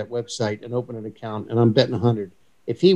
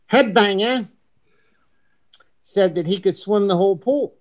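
a light, higher-pitched imitation of an English accent; 7 semitones higher than usual. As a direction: tease him in a lightly mocked English accent. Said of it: "he's with his perfect English accent".

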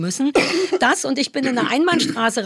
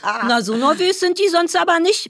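higher-pitched mock examiner remark; imitated examiner; 7 semitones higher than usual. Storyteller quoting the examiner on her trembling feet, high mock-examiner voice. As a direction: play the examiner commenting, pitched up. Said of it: "Der Prüfer meinte".

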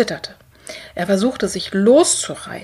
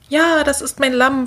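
high, joyful exclamation; overjoyed child's reply; delighted; 6 semitones higher, 4 dB louder than usual. A narrator's reading. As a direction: burst out with delight, pitched up. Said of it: "freut sich Simon".

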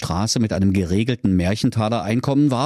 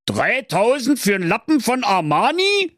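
high, indignant Berlin outburst; shocked at the price; outraged; 12 semitones higher than usual. Acted. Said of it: "so empört". (hoch empört, mit Berliner Schnauze)

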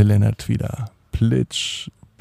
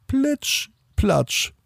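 high splash sound; 13 semitones higher, 4 dB quieter than usual. A narrator's reading. Imitating footstep splash.

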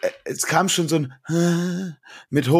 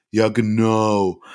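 very slow, stretched sarcastic agreement; drawn-out mock ja genau; 3 semitones lower, much slower than usual. Imitating coworker Robert's sarcastic reply: slow drawn-out ja genau. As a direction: drag it out like a bored coworker. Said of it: "Vorne Inventur und hinten".

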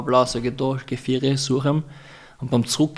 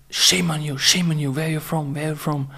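empörte Frau; 4 semitones higher than usual. Retold or imitated. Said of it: "wieder gegangen ist".

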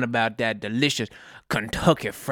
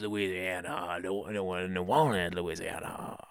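Busky voice; 9 semitones lower, 13 dB quieter than usual. Acted. Sidekick Busky answers in his voice.

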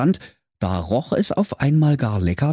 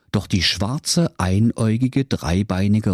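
plain reading voice

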